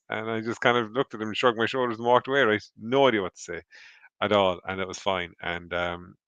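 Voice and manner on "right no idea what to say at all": ordinary talk, nothing marked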